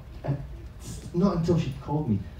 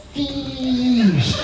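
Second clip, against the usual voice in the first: Fish called much higher and louder; insistent shout; 12 semitones higher, 6 dB louder than usual. Retold or imitated. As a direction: repeat it louder each time. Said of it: "she just kept saying it".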